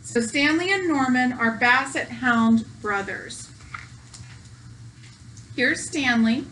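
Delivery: ordinary delivery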